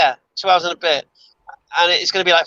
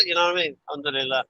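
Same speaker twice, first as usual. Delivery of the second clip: ordinary talk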